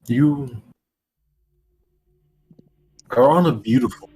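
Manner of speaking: scene setting. Acted. Game master sets the scene.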